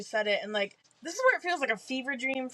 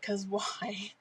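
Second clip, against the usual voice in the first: laughing whisper; 3 semitones lower, 5 dB quieter than usual. Real, not acted. Judging the heroine's choice.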